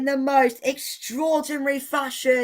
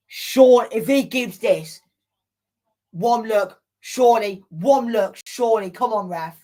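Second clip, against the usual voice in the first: normal talking voice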